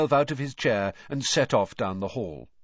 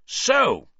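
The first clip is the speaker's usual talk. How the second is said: loud, much higher accusing "So"; angry; 11 semitones higher, 8 dB louder than usual. Acted. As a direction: pounce on him with it. Furious uncle confronts Harry.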